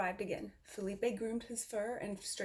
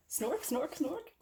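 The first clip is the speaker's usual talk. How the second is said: rising, higher doggy snorts; dog snorting; 7 semitones higher, much slower than usual. Acted. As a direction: snort like a happy dog, climbing up.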